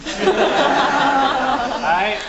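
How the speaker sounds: high loud laughing. Real, not acted. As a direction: say it through laughter. Laughing after a joke.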